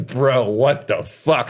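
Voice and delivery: drawn-out, stunned "bro, what the fuck"; slowly, in utter disbelief; stretched-out stunned complaint. Retold or imitated. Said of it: "I was like".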